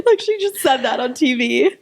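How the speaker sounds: high, breaking into laughter while talking; cracking up in disbelief; real laughing. Real, not acted. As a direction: squeeze the words out through giggles. Cracking up at retold line.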